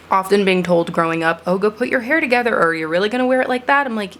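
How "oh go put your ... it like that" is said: mock nagging adult. Mimicking adults' hair comments.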